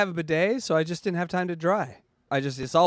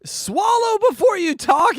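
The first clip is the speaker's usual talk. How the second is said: mock yell; 7 dB louder than usual. Teasing friend who is eating.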